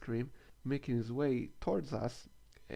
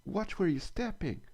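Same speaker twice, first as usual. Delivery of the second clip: retold yell; 7 semitones higher than usual. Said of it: "he yelled at me".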